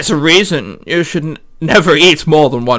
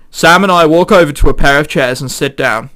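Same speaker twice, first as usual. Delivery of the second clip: ordinary talk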